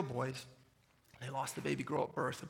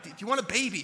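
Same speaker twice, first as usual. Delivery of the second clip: louder, higher repeated call; retold yell; 5 semitones higher, 8 dB louder than usual. Husband repeats his question across the parking lot, retold yell. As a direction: call it over the distance, imitating a yell. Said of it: "I yell across the parking lot".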